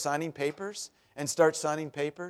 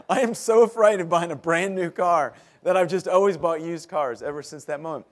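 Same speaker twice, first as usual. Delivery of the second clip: usual speaking voice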